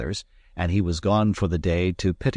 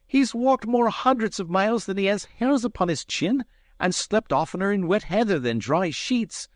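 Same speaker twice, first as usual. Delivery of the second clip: angry Scots retort; 14 semitones higher than usual. Acted. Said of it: "with a kind of fury".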